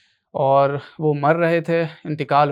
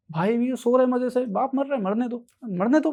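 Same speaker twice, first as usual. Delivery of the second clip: higher-pitched mock unconcern; mock indifference; 6 semitones higher than usual. Commentator mimicking vloggers beside their dying father, mock indifferent. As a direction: put on a careless, higher voice.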